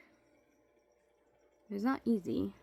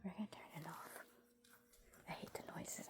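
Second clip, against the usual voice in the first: whisper; 2 semitones lower, 15 dB quieter than usual. Real, not acted. In basement, turning lights off.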